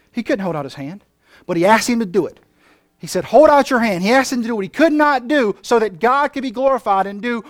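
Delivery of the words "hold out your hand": loud command. Shown as a demonstration. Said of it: "repeats the command loudly".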